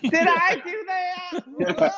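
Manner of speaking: high, slow nerdy whine; Urkel voice. Pretend. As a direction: squeak it out like a cartoon nerd. Mock Urkel catchphrase after overturning Roe.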